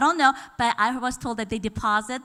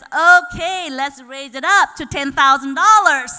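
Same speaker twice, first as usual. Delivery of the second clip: loud, laughing auctioneer shout; booming auctioneer call; 11 dB louder than usual. Retold or imitated. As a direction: announce it like an auctioneer. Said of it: "do I sound like an auctioneer".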